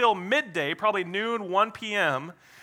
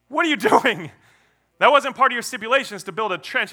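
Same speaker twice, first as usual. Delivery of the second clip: loud, high-pitched objection; hammed-up bystanders calling out in disbelief; baffled protest; 6 semitones higher, 5 dB louder, faster than usual. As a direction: challenge him, incredulous and quick. Crowd protesting to Elijah.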